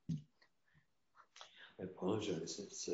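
slow, soft, choked-up apology; fighting back tears as he apologizes; voice breaking with emotion. Real, not acted. Rabbi apologizing to interviewer, voice breaking with emotion. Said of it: "Gosh, I don't know why I'm so emotional".

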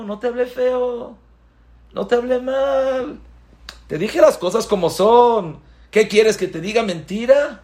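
mock indignant defense; defensive denier. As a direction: brush off the accusation. Speaker denies speaking badly.

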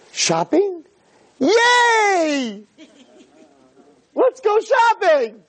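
high squealing delight; excited wife voice. Retold or imitated. Mimicking wife's excited shopping cry.

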